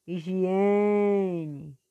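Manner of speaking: "higiene" with a long, loud E in the middle; teacher dragging out GIE in "higiene"; stretched stressed syllable. Shown as a demonstration. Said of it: "puxando mais a sílaba forte".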